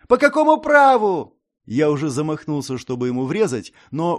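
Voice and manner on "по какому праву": loud, high outraged demand; indignant shout. A narrator's reading. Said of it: "возмутился хлыщ".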